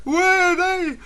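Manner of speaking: put-on panicked nine-year-old screaming for help; mock panicked scream. Lost boy screaming at the French boy, panicked. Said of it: "screaming at him".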